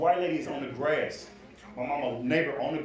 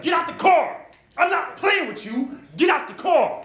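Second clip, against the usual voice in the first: high, loud mock yell at someone; 12 semitones higher, 8 dB louder than usual. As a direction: yell an angry order, pitched way up. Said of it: "he yelling in the car".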